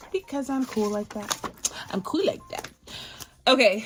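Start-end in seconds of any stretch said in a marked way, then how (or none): 1.9-2.7 playful whisper. Pretend. Echoes own brag in put-on whisper.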